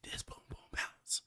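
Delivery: cheeky whispered brag; sly hushed aside. Pretend. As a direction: breathe it out like a secret.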